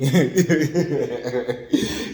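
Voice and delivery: a loud, open laugh; hearty laugh. Real, not acted. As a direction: burst out laughing at the joke. Laughs at own joke about money.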